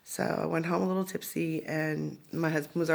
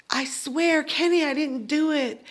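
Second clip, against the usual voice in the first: frightened pleading; 8 semitones higher, 3 dB louder, slower than usual. Retold or imitated. Pleading with gunman.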